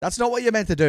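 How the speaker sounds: ordinary talk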